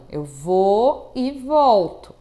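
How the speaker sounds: stretched out to follow the hand; slow demonstration. Shown as a demonstration. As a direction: draw the words out slowly with the gesture. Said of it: "tem uma duração". (fala lenta, acompanhando o gesto)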